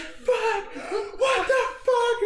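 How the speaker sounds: slow, very high tearful shriek; mock screaming and crying. Storyteller imitating his terrified friend, screaming and crying. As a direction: wail it like a panicked friend, hammed-up. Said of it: "He's, like, screaming and crying".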